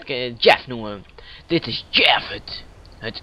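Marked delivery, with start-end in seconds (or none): 2.3-3.2 whispered stumble. Real, not acted. Gamer introduces his new horse: whispered aside. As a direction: drop to a whisper mid sentence.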